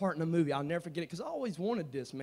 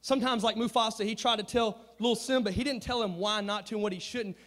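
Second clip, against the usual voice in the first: ordinary delivery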